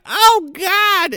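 high-pitched hammed-up shriek; mock horrified cry. Acts out cheerleader sprayed with blood.

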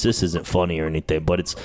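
ordinary delivery

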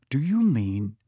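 ordinary delivery, nothing marked

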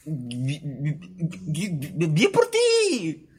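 choked-up, squeaky bien por ti; stammered fake congrats.

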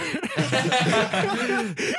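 high burst of laughter; loud laugh. Real, not acted. Delighted laugh.

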